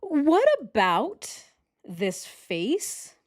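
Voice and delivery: high, drawn-out challenge; incredulous. Real, not acted. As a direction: stretch the opening up high, then leave the question hanging. Incredulous challenge over a photo.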